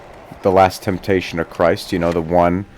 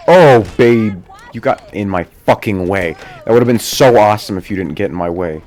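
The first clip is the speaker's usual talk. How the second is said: louder, exasperated complaint at the other skater; loud, real irritation at the skater who cut him off; frustrated outburst; 2 semitones higher, 8 dB louder than usual. Real, not acted. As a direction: vent at being blocked mid-trick. Other skater blocks his trick.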